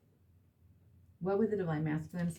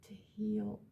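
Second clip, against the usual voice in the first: a hushed prayer for healing; whisper; 4 semitones higher, 7 dB quieter than usual. Real, not acted. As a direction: whisper it softly, higher. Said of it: "unvoiced whisper".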